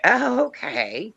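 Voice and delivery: high, slow drawn-out acceptance; mock-polite rising okay; retold puzzlement. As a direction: go along while clearly baffled.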